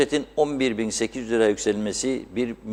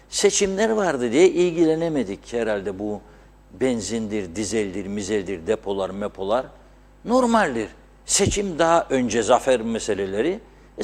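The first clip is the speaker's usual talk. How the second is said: ordinary delivery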